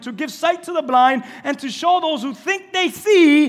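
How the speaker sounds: loud, high fiery preaching; preaching at full force. Acted. Pastor preaching the healed blind man story, fiery and loud.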